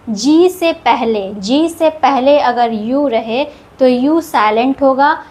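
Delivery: usual speaking voice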